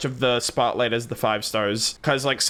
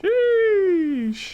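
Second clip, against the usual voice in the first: squeaky, much higher impressed sheesh. Real, not acted. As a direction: let a high-pitched sheesh slip out, impressed. Reacting to threat of vengeance.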